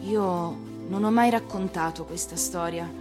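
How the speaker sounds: ordinary delivery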